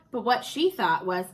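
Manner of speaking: plain reading voice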